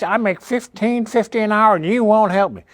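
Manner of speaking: high mocking whine of a complainer; imitating a customer who wants help; whiny customer. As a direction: mimic a whiny caller. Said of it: "the people say".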